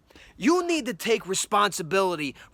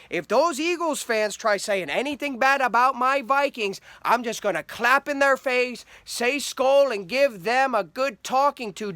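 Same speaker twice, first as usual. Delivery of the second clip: lightly voiced, perky Minnesota accent; mock tough talk in a cute Minnesotan voice; first fan boasting back in a light Minnesota accent. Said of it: "couldn't wait to tell all your friends".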